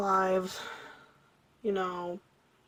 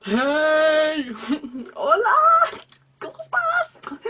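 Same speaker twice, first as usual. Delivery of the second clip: high, loud Spanish greeting; super loud; 7 semitones higher, 12 dB louder, much slower than usual. Retold or imitated. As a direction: shout the hello across the room. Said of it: "super loud".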